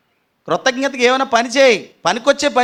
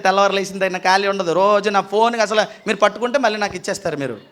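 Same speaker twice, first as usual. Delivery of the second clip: usual speaking voice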